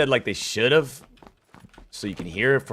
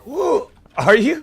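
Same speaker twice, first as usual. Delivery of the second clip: much higher, loud woo; excited whoop; 14 semitones higher, 8 dB louder, much slower than usual. Real, not acted. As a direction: cheer it out, thrilled. Cheering in game.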